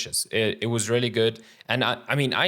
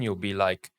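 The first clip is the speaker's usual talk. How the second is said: ordinary delivery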